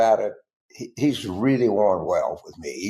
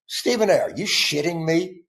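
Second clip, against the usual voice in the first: incredulous outburst; 6 semitones higher than usual. Real, not acted. Disbelief at Stephen A's claim.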